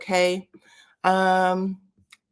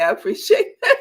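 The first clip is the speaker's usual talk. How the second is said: louder, higher, breaking into laughter; laughing; 4 semitones higher, 6 dB louder than usual. Real, not acted. Host amused by a chat comment, laughing. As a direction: laugh through the thanks.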